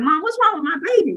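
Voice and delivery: mock frantic plea to Mom; panicked plea. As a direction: blurt it out to Mom, frightened and rushed. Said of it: "now I'm crying".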